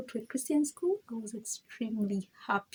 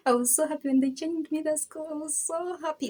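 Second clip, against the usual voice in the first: excited; 8 semitones higher than usual. Real, not acted. Recalling joy at new school.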